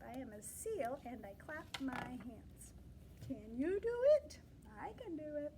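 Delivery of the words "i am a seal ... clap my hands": soft, hushed reading of the page; whispered read. Shown as a demonstration. Teacher whispering the sentence to the children. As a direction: whisper the line as a secret.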